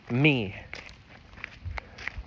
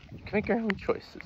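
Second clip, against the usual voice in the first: real voice crack, pitched high and fragile; 9 semitones higher than usual. Real, not acted. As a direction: let the voice crack upward mid-line. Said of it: "Why did my voice crack like that".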